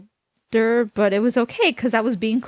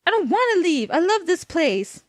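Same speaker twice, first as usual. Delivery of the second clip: put-on high, pleading girl; girl's voice; 9 semitones higher than usual. A narrator's reading. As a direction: beg like an upset young girl. Girl protesting leaving home.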